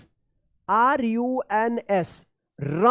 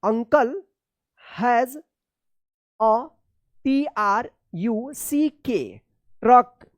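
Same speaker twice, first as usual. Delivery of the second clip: usual speaking voice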